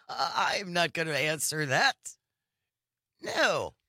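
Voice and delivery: imitating a dismissive laughing refusal; mocking laughter. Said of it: "It is the laughter".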